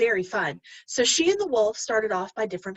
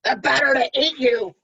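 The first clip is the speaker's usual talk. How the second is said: loud pouncing wolf cry; spring the trap with a roar; cried out; 4 semitones higher, 6 dB louder than usual. Acted. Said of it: "cried the wolf".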